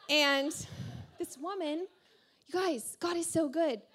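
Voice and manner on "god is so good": normal talking voice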